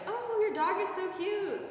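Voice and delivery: high, slow gushing compliment; mock sweet gush; gushing imitation of herself. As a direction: coo over the dog in a high voice. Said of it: "I was like".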